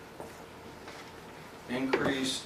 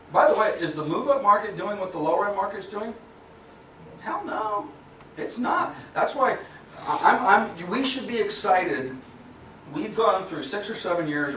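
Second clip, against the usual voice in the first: excited; 8 semitones higher than usual. Real, not acted. Excited rant about the market.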